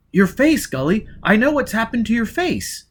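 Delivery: high, loud and urgent; agitated. A narrator's reading. Gisbella telling Foyle about his face, agitated. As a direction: press it on him, shaken.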